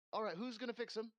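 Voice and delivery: high, fast, take-charge voice; brisk innkeeper. Acted. Innkeeper asks who will heal the patient, brisk and high. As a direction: take command of the room, high and quick.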